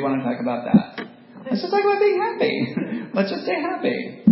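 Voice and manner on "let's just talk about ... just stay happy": high, bright mock-cheery chirp; sing-song fake happiness; forced cheerful voice. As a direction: play the relentlessly happy self, pitched way up.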